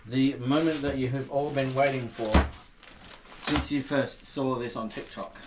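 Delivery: slow showman announcement; announcer voice. Acted. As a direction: build up the big moment slowly. Announcing the challenge.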